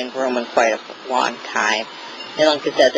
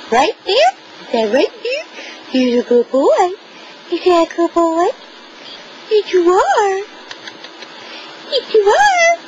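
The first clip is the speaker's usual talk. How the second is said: high squeaky voice praising a dog. Real, not acted. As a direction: coo at the puppy, very high and bright. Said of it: "the top baby talk to my puppy".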